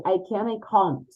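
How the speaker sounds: British accent, "can't" with a long open ah; British accent, "I can't" said as "I cahn't". Shown as a demonstration. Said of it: "British would say".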